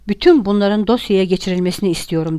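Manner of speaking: ordinary delivery, nothing marked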